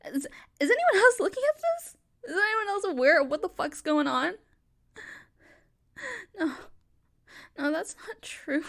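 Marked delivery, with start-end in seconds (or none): 0.1-4.3 high, loud cry out to the room; desperately appealing to bystanders; panicked call for help. Acted. Woman calling out to the crowd for help, panicked and loud.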